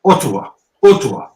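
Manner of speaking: "Ottawa." said as "oo-tu-ah", each syllable spelled out; "Ottawa." with a rounded oo start and an open ah at the end; monotonal pronunciation. Shown as a demonstration. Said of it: "Sounds like Ottawa".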